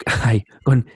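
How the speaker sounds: ordinary talk, nothing marked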